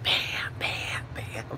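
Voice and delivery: soft whispered "bam, bam"; whispered lyric. Shown as a demonstration. Host whispering the [name] song to viewers. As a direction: breathe the beat words in a whisper. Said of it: "The Whisper song".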